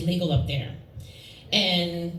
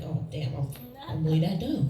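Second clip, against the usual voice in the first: deflated self-mockery; 5 semitones lower, 4 dB quieter than usual. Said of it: "I'd be like".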